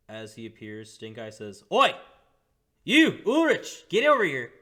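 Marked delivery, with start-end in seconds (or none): 1.7-4.5 high, loud shout across the room; loud call. Acted. GM voices Stink Eye shouting.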